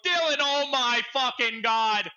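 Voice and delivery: slow, loud, high cry of disbelief; telling teammate Dylan about the big mistake; exasperated. Retold or imitated. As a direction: call out to a friend in stunned frustration. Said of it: "And I go".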